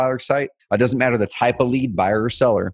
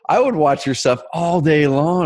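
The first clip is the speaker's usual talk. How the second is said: excited; 7 semitones higher, slower than usual. Retold or imitated. Encouraging the shy guy.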